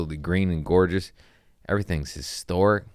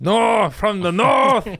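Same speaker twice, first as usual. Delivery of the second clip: high, slow, loud hammed-up Northern Irish accent; mock Northern Irish shout; 7 dB louder, slower than usual. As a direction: belt it like a Northern Irish local. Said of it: "You Scottish?".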